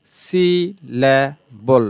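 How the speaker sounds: সিলেবল drawn out as সি, লে, বল; split into syllables. Shown as a demonstration. Teacher giving a three-syllable example, সিলেবল slowly broken up. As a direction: stretch each of the three parts of সিলেবল. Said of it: "তিনটা শব্দাংশ".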